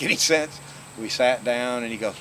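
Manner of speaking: ordinary talk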